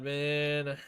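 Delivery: normal talking voice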